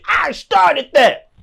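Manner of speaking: high, slow mocking voice; mock boast. Mocking a bragger in a high drawn voice.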